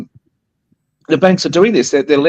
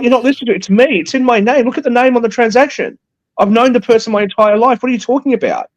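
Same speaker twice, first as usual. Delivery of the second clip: much higher, exasperated retold outburst; exasperated rant; 11 semitones higher than usual. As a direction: replay the moment you lost patience with the bank, pitched way up. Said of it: "I'm like".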